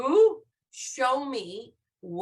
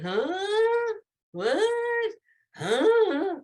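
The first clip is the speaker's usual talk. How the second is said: "Huh? What? Huh?" pitched high as puzzled questions; high, quizzical "Huh? What? Huh?"; questioning; 9 semitones higher, much slower than usual. Shown as a demonstration. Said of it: "It's a question mark".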